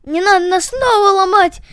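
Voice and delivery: high, loud frightened plea. Acted. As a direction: plead in alarm not to be hurt. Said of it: "испугались кости". (испуганно, громко)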